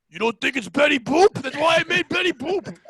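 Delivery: loud, emphatic God talking back; imagined God. Acted. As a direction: boom it out as an exasperated God. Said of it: "God's like".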